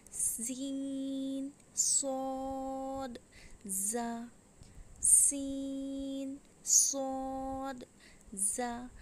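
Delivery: सीन and सौद held very long, hissed out; whistling letters. Shown as a demonstration. Teacher naming the whistling letters, very drawn out. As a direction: stretch सीन and सौद far out, the स hissing. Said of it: "हुरूफ़े सफ़ेरिया".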